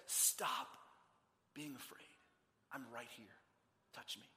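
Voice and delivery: very soft, low, with long pauses; calm the frightened almost in a whisper; hushed reassurance. Acted. Jesus reassures the fearful, hushed.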